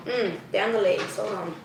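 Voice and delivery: usual speaking voice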